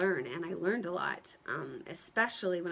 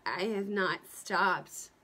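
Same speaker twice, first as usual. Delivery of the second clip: ordinary talk, nothing marked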